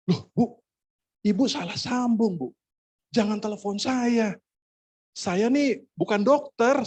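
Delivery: high-pitched, exasperated objection; acting out disbelief at a wrong call; baffled protest. Startled protest to the caller.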